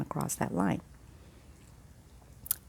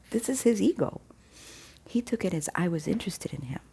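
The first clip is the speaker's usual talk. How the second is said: normal talking voice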